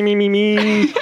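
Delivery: high frog-puppet warm-up; Kermit. Pretend. Host warming up for co-hosts, Kermit voice. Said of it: "and Kermit".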